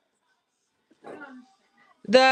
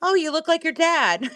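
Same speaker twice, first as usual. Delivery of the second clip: teasing; 7 semitones higher than usual. Retold or imitated. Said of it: "I was like".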